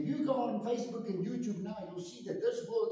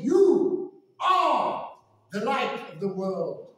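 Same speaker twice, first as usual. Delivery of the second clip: emphatic statement; 8 dB louder, slower than usual. Shown as a demonstration. Preacher shows how the Lord really said it, emphatic proclamation. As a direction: proclaim it with full authority, word by word. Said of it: "It was an emphatic statement".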